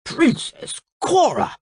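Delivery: high, slow, scandalised call; shocked shout. A narrator's reading. King shocked at the torn-off dress, shouting. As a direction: cry the name out high, appalled. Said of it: "he shouted".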